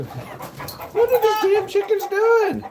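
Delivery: high, slow, loud yell at chickens; exasperated yell. Real, not acted. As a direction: holler in disbelief at the birds. Yelling at chickens.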